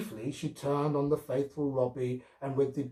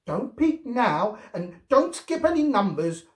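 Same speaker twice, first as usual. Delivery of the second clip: warning; 10 semitones higher, 8 dB louder than usual. A narrator's reading. Girl warning Robbie not to cheat while counting. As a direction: warn him like a strict little girl. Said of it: "warned Gloria".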